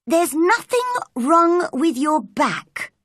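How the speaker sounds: high-pitched, firm pronouncement; cross. A narrator's reading. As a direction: tell him crossly and firmly. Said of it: "crossly spoken".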